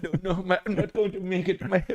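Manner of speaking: put-on high pained Indian accent voice. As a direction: Indian accent, stuck in trap. Continues bear trap guy impression.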